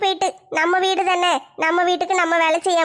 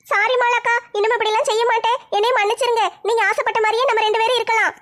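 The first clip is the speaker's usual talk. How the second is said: higher, apologetic younger sister; a lightly tearful kid sister, pitched up; younger sister pleading; 5 semitones higher than usual. Acted. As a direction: beg forgiveness as the little sister.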